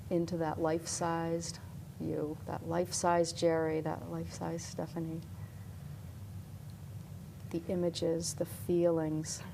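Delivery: calm hypnosis voice; guided visualization. Acted.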